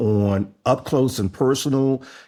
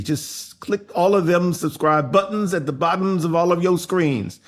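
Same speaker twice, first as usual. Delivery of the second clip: ordinary talk, nothing marked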